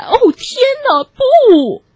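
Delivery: acted tearful cry, rising to a loud "no"; despairing wail. A narrator's reading. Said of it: "小猪绝望地哭起来".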